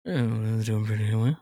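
low, soft seductive voice; sultry ladies' man purr; lothario. Pretend. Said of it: "That was kinda sensual".

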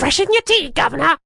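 high-pitched, hammed-up mock Cockney; cartoonish Cockney squawk; terrible Cockney accent. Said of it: "do a terrible Cockney accent".

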